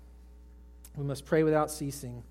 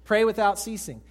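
louder recital; 6 semitones higher, 8 dB louder than usual. Shown as a demonstration. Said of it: "Got it memorized?".

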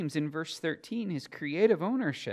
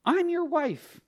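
high, raised mock-wife voice; jealous wife; 12 semitones higher, 5 dB louder than usual. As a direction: stake the claim as an acted jealous wife. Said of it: "guard her husband jealously".

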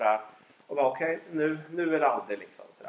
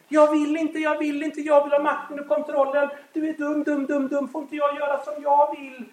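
put-on defiant child; 11 semitones higher, 6 dB louder than usual.

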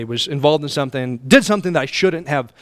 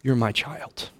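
softer, lower voice of God; tender; 4 semitones lower, 6 dB quieter, slower than usual. Acted. God forgiving his child, softer and tender. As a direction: gently reassure a child. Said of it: "and says".

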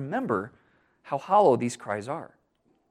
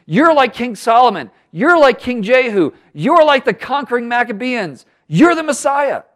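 higher, louder, rising fervour through each line; excited crowd acclaim; 9 semitones higher, 6 dB louder than usual. Retold or imitated. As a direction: hail him like a cheering crowd. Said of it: "tying it into their history and saying".

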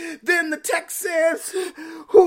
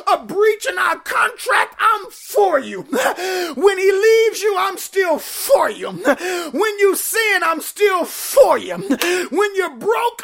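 preaching at full force. Acted. Preacher voicing God's promise.

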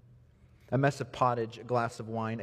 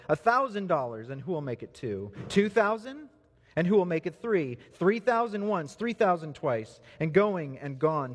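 excited auctioneer; 6 semitones higher than usual. Acted. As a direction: sell it fast to the cheering room. Auctioneer now selling prized violin.

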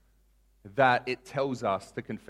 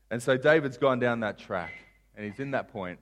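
normal talking voice

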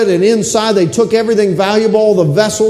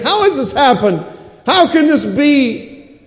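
Jeremiah crying out; 7 semitones higher than usual. Retold or imitated. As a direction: cry it out like a grieving prophet. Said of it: "He's crying out and he's saying".

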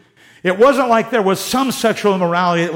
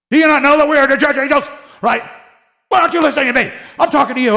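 higher, louder and fast, like a fed-up apostle; exasperated scolding; 8 semitones higher, 5 dB louder, faster than usual. Retold or imitated. Paul scolding the Corinthian church, exasperated loud rebuke. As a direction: scold them hard for not listening. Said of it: "he's talking like this the whole time".

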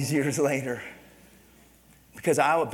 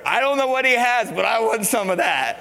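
quoted factory workers; 9 semitones higher, 9 dB louder than usual. Retold or imitated. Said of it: "you could hear 'em".

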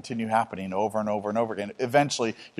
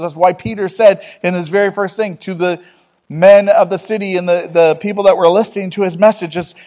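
raised, urgent sermon voice, pushing higher and louder; fiery preaching; 8 semitones higher, 6 dB louder than usual. Acted. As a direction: drive the call to change home from the pulpit. Preaching about repentance.

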